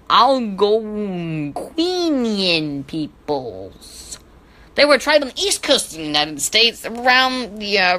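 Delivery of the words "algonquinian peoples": slow, stretched-out "Algonquinian"; drawn-out name. Real, not acted. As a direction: draw "Algonquinian" out syllable by syllable. Sounds out a hard name theatrically.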